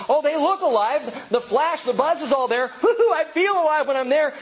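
high-pitched gushing thrill with a woo-hoo; hammed-up, high-pitched enthusiasm; mock excitement. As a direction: imitate people giddily feeling alive. Voicing people thrilled by dead places.

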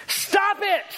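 high-pitched exasperated yell; sudden high holler of a fed-up therapist; retold yell. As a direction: yell it in her face. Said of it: "he just yells in her face".

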